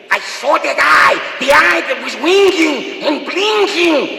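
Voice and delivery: creepy character screaming, high and slow; Peter Lorre. Acted. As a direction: scream it like nails on a chalkboard. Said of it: "and then when he screamed".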